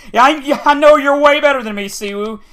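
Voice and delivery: sarcastic impression of a taunting winner; taunting opponent. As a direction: mimic a smug rival rubbing it in.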